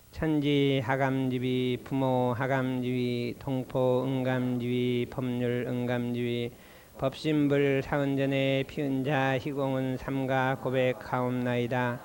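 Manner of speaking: slow, low, measured chant of a prayer; prayer recitation. Acted. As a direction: intone each phrase slowly like a liturgy. Leads a formal prayer recitation. (느리고 낮은 기도문 낭송)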